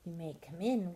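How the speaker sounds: ordinary delivery, nothing marked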